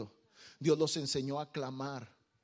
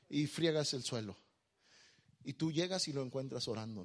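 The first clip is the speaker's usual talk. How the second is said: normal talking voice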